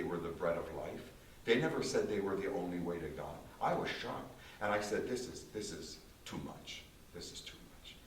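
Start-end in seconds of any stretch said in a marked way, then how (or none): 5.1-8.0 overwhelmed hush. Retold or imitated. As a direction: let it sink in, quietly overwhelmed. Shocked self quoted in disbelief.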